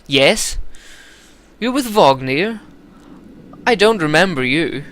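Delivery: a bad, haughty English prince accent; lightly put-on English accent, aloof. Prince Ludin greeting the scribe, bad English accent. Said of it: "do a fucking English accent".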